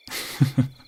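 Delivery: airy teasing laugh; a little breathy chuckle to tease her. Acted. King teasing his lover.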